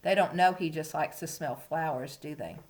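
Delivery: usual speaking voice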